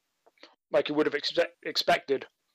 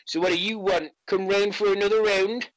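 put-on cocky kid; 6 semitones higher than usual. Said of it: "He just looks up at you just like".

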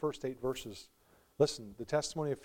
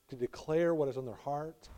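ordinary talk, nothing marked

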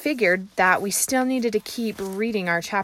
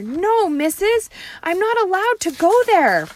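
panicked pleading; 11 semitones higher, 7 dB louder than usual. Acted. Junie B. begging her teacher, high panicked plea. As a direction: beg not to be taken like a scared kid.

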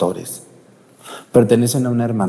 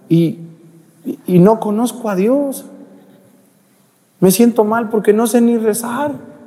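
high, plaintive lament of a patient; pitched-up, sorrowful retelling; tearful sick man; 11 semitones higher than usual. Retold or imitated. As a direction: imitate a man weeping over his regrets. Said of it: "ese señor estaba llorando".

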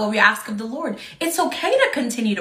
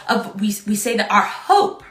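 usual speaking voice

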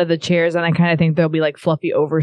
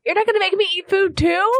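high whiny protest. Acted. Player protests ordering food.

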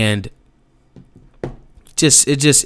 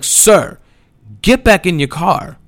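higher, firm mock command; retold cop's order; 7 semitones higher than usual. Cop sending the driver back to his car, mock firm.

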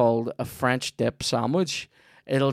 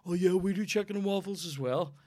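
mild mock voice of smug rival restaurants, higher; 7 semitones higher than usual. Host mimicking rival cafes boasting of chaffles, in a mild sneer. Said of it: "People going".

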